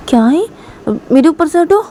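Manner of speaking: high, loud angry shout to get off; गुस्से से चिल्लाना. Acted. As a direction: snap at him to get off her. रुई गुस्से में चिल्लाती है. (ऊँची आवाज़ में गुस्से से चिल्लाना)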